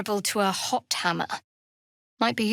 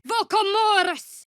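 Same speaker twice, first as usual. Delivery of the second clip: high, loud incantation; shouted high cast; spell shout; 9 semitones higher, 5 dB louder than usual. Acted. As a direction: hurl the spell word. Casting a spell.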